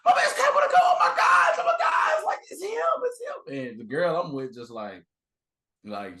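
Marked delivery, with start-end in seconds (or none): 0.0-3.7 high, loud squealing fangirl; screaming teen. Retold or imitated. Teen fan telling her mom who he is, shrieking.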